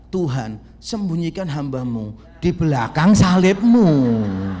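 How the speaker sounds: loud, fervent preaching. Acted. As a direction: preach it out to the congregation, full force. (berkhotbah dengan lantang dan berapi-api)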